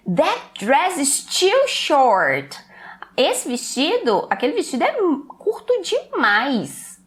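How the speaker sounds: mild, slow disapproving dad; lightly voiced father scolding; a hint of a stern father. Father to daughter dressing for a party, mildly stern. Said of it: "o pai falando com a filha".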